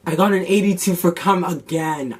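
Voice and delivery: loud, high frustrated complaint. Real, not acted. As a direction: vent the annoyance. Upset about chem grade.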